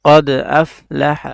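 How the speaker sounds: very slow, drawn-out recitation of قد أفلح; Quranic recitation. Shown as a demonstration. Teacher reciting the verse slowly for students. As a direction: chant each sound slowly and clearly.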